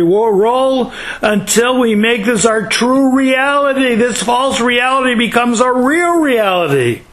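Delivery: fervent preacher building to the lesson; impassioned preaching. Real, not acted. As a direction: drive the moral home to the congregation. Preaches the moral with rising fervour.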